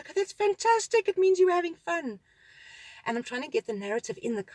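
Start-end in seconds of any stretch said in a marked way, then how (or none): 0.1-2.2 much higher, louder cheerful praise; upbeat parent cheering a child; enthusiastic encouragement. Retold or imitated. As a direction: sell it to the kid as great news.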